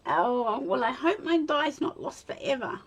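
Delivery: ordinary talk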